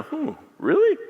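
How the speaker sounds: very high doubting Hmm, really?; skeptical surprise. Retold or imitated. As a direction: sound amazed and doubtful at once. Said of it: "And I'm kinda".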